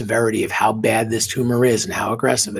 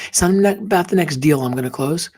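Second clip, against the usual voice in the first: usual speaking voice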